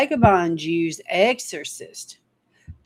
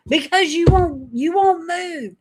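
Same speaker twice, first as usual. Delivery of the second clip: exasperated prophet; 9 semitones higher, 3 dB louder than usual. Acted. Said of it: "And he's like".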